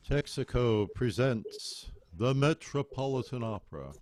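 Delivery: loud, grand opera broadcast introduction; old radio announcer. Retold or imitated. Host recalling childhood opera broadcasts, booming announcer voice. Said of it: "my best announcer voice".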